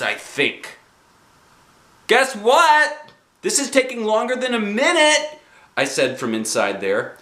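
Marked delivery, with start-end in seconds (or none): 2.1-5.4 put-on high, bouncy kid voice; chirpy child voice, pitched up; little girl Junie B. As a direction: play a bossy six-year-old.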